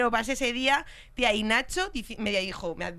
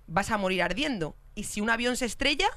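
normal talking voice

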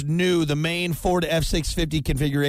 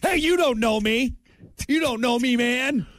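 fake tough guy swagger; 8 semitones higher than usual. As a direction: puff up and wave him off. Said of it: "he's gonna go out there and be like".

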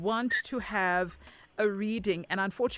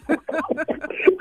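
laugh; 9 semitones higher, 9 dB louder than usual. Real, not acted. Host laughs at caller.

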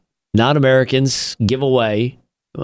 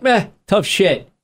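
unbothered foreign server; 7 semitones higher, slower than usual. Retold or imitated. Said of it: "they're like".

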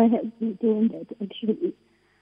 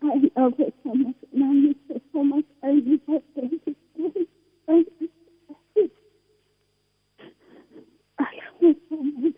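higher, breaking voice through tears; crying; 6 semitones higher than usual. Real, not acted. Daughter tells her late mother she misses her, crying. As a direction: sob out her love for her mother.

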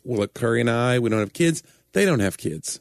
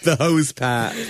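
mock British; 4 semitones higher, slower than usual.

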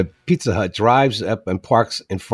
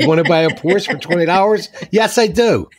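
eager yes; 7 semitones higher than usual. Pretend. Host acting out the Porsche deal. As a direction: jump at the offer.

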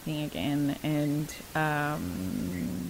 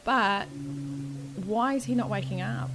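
relieved, then questioning; 7 semitones higher than usual. Retold or imitated. Retelling relief, then question to doctors.

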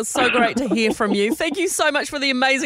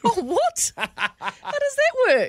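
high and slow, laughing in disbelief; 9 semitones higher, much slower than usual. Real, not acted. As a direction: laugh through the question. Disbelief at unbuilt hotel story.

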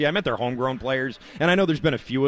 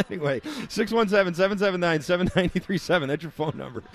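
normal talking voice

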